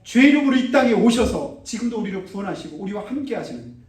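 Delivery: high, loud, impassioned sermon; full-force preaching. Acted. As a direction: drive the warning home to the congregation.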